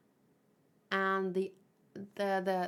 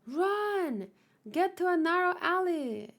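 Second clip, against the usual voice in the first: high, urgent cry of warning; urgent calling out; 9 semitones higher, 3 dB louder than usual. Acted. Urging others to flee to a narrow alley, calling out urgently. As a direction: send them running with a raised pitch. Acted urgent order in the story.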